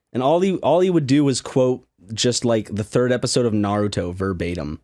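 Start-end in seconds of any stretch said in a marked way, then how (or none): none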